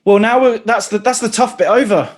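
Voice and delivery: high, loud mock relief; bright burst of relief; playful relief. Real, not acted. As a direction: announce the hard part is over with glee. Host shifts to playful relief.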